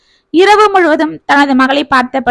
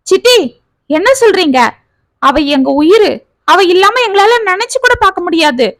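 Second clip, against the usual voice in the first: high, pained cry of disbelief; anguished plea; 6 semitones higher than usual. A narrator's reading. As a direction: plead in anguish, voice climbing. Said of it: "என்று துடித்தான் சேகர்".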